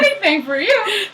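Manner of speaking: high, loud gushing devotion; hammed-up eagerness; Hermes, eager servant. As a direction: squeal it like an overkeen helper. Hermes eagerly obeys Zeus.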